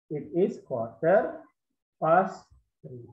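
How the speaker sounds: quarter drawn out, each word slow; very slow model. Shown as a demonstration. Teacher introduces quarter to pupils, very slowly. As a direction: spell it out slowly for the class. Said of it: "seperempat itu adalah quarter".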